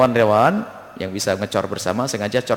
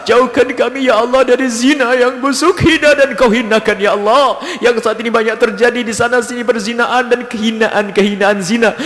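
pleading climax, higher; impassioned prayer; 9 dB louder than usual. Acted. Imam pleading for the congregation at prayer's peak, impassioned and higher. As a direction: cry out the plea against sin with rising fervour.

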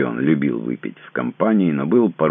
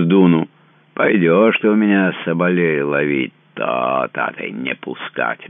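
put-on drunken slur; 6 semitones higher, 6 dB louder than usual. Drunk husband threatens the lawyer.

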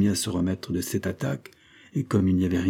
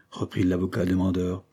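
plain reading voice

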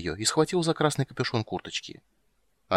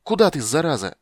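exasperated yell, raised in pitch; shouting; 7 semitones higher, 6 dB louder than usual. A narrator's reading. Witcher calls after the fleeing girl, shouting crossly. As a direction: yell angrily after a runaway. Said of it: "крикнул Геральт".

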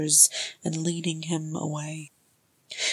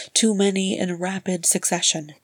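ordinary delivery, nothing marked

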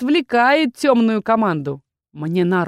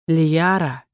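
muffled voice; 4 semitones lower than usual. Acted. Captain calls the heroine from the gloom, muffled. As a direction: speak low and hollow. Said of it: "его глухим голосом".

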